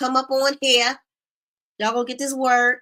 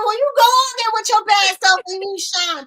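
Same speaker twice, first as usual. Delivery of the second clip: cheering her on; 8 semitones higher, 6 dB louder than usual. Retold or imitated. Host cheering Felicia on.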